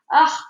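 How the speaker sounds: loud pained ugh; pained moan. Acted. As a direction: groan clutching a hurt knee. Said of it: "moaned the alien".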